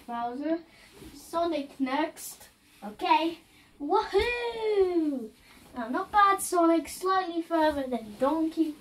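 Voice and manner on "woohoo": high whooping cheer; playful victory whoop; excited cheer. Pretend. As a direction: whoop it out like a toy hero leaping. Figure cheers after a jump.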